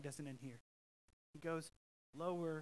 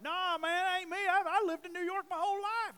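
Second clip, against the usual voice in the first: much higher, louder put-on voice denying his roots; southerner denying roots; 13 semitones higher, 8 dB louder than usual. Southerner up north denies where he's from, high mock voice. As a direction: play a southerner insisting he's a New Yorker. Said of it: "you're like".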